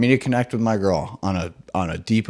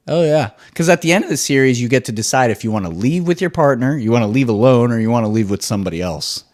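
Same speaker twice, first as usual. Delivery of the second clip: normal talking voice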